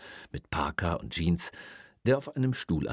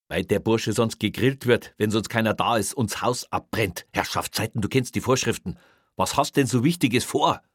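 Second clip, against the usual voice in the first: louder, impatient retort; irritated rebuke; 5 semitones higher, 6 dB louder than usual. Acted. Boss snaps back in argument.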